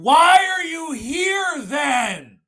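very high, loud, drawn-out scream; all-out outraged yell, every word stretched; top-of-lungs scream. Acted. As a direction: scream it across a theater. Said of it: "at the top of my lungs saying".

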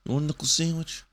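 corny schoolyard threat; tough guy taunt. Acted. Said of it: "the old insults".

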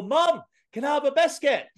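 put-on high, loud Scottish kid calling Mom; Scottish accent. Son calling to his mother, switching into Scottish accent. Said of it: "it's very much got a Scottish accent".

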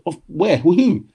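high, alarmed retold questions; a young player thrown by news of his sale, asking in surprise; surprised questions. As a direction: ask it in sudden alarm. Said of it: "I said".

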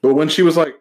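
ordinary talk, nothing marked

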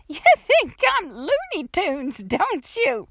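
put-on slow, high old-lady challenge; offended old woman. A narrator's reading. Old woman confronts trapped girl, high and offended.

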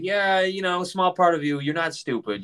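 lightly voiced sarcastic reply. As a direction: sarcastically agree with them. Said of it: "I was like".

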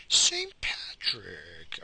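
drawn-out name. Shown as a demonstration. Mulling the name over while searching, dragged slowly. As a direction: say Saint Patrick very slowly, stretching every sound.

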